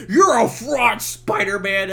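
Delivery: high, loud hammed-up yell; mock enraged. Harry rages at Spider-Man in the retold scene, mock-furious shout. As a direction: bellow it like an overacted movie tantrum.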